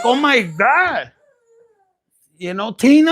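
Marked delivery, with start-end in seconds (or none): 0.0-1.1 high, loud, drawn-out gasp of disbelief; mock shocked exclamation. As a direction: exclaim it slowly, pitched up and loud. Mock reaction to retold antics.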